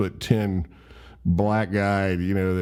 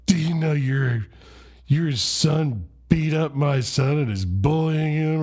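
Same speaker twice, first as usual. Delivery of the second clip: higher-pitched, indignant mock confrontation; livid mom; 7 semitones higher than usual. Livid mom confronting the other boy's dad, indignant. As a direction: confront them as an outraged mother. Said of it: "is so livid".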